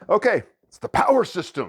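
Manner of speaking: high, loud showman reveal on power; excited announcement. Acted. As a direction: announce it like a game show host. Announcing the game's name.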